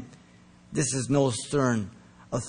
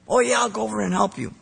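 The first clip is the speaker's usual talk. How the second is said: breezy eager promise; 8 semitones higher, faster than usual. Retold or imitated. Imitating eager promise to friend.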